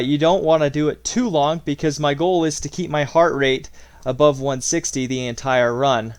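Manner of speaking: ordinary delivery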